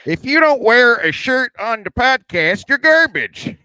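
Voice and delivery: high, loud, slow host voice; Are You Garbage host impression. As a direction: bellow the catchphrase like the host. Mocks the show's catchphrase.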